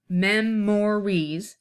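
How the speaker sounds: MEMORIES stretched into MEM, MOR, EEZ, evenly weighted; syllable by syllable. Shown as a demonstration. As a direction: count out memories slowly in three full syllables. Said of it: "has three syllables".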